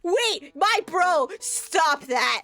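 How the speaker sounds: high, loud shrieks and swearing; slow, stretched panicked yells; real shock. Real, not acted. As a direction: scream through a jump scare. Jump scare, screaming in shock.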